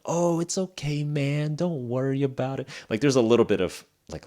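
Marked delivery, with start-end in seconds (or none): 0.1-2.7 reassuring friend, pitched higher; a friend consoling over a breakup, gently reassuring; friendly. Shown as a demonstration. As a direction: comfort a sad buddy kindly. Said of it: "I added a little bit of friendly feeling to it".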